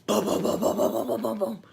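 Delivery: loud booming bangs on the garage; loud imitated pounding; imitated banging.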